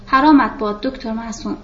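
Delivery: ordinary delivery, nothing marked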